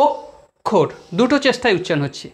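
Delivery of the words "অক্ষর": অক্ষর in two separate beats, অক্ + ষর; split into syllables. Shown as a demonstration. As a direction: break অক্ষর into two syllables with a clear gap. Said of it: "দুটো সিলেবেলে ভাগ হচ্ছে". (অক্ষর শব্দটা দুই সিলেবলে ভেঙে, মাঝে থেমে উচ্চারণ)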